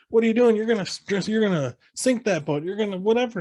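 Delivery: high mock yell at everyone; mock yelling sheriff. Sheriff yelling at overloaded boats, high mock yell. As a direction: yell at the whole crowd. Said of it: "He's like".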